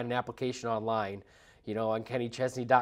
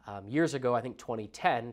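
usual speaking voice

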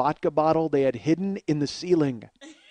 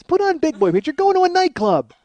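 loud, high-pitched scolding; high, loud and exasperated; exasperated rant; 12 semitones higher, 8 dB louder than usual. Real, not acted. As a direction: raise your voice in comic outrage. Ranting at badly dressed club-goers.

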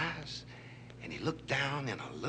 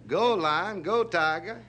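higher, louder cheerleading chant; egging-on cheer; 6 semitones higher, 4 dB louder, slower than usual. Acted. Vulture urging lion and tiger to fight, cheering.

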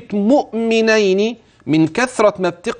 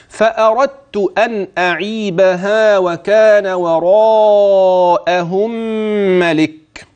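slow, drawn-out melodic chanting; slow louder Quranic recitation; 2 semitones higher, 3 dB louder, much slower than usual. Shown as a demonstration. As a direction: chant the verse with full tajweed. Recites the verse.

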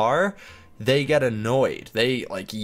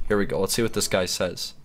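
usual speaking voice

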